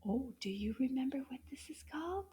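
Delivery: put-on hushed, secretive question to the kids; playful whispered quiz question.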